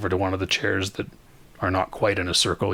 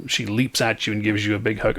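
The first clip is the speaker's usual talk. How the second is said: ordinary delivery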